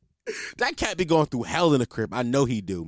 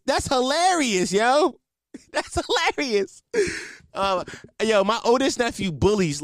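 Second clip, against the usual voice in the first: usual speaking voice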